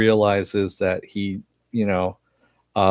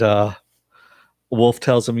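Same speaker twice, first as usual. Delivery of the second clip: normal talking voice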